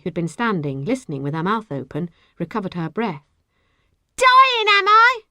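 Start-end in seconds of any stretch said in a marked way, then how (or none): 4.2-5.2 high, loud indignant shriek; top-of-the-voice scream. A narrator's reading. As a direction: scream it out in outrage. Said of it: "screamed at the top of her voice".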